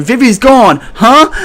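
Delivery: high, rising double take; mock bewilderment. Agents realising Vivi has escaped, mock bewilderment. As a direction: jump up in pitch on a baffled huh.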